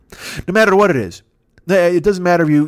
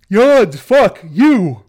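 big loud yawn, then a louder fuck you; 7 semitones higher, 7 dB louder, much slower than usual. Acted. To the podcast audience, a mock-hostile loud yawn and curse. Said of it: "that was a loud yawns".

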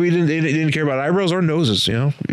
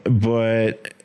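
normal talking voice

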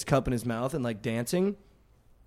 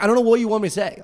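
higher, louder exasperated plea; 8 semitones higher, 4 dB louder, much faster than usual. Retold or imitated. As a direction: say it again, pleading louder. Repeated louder.

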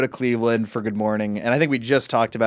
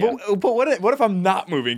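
whiny protest; 8 semitones higher than usual. Real, not acted.

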